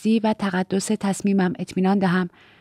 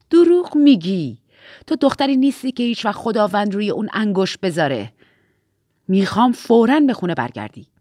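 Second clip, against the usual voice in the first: very angry; 5 dB louder than usual. A narrator's reading. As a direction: accuse angrily, leaning hard on the first words. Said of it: "خیلی عصبانی بود". (با خشم و تحکم)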